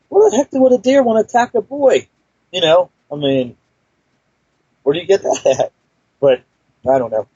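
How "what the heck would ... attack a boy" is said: much higher, disbelieving inner voice; incredulous thought. Retold or imitated. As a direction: scoff at the idea in a high, unbelieving tone. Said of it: "And I'm thinking".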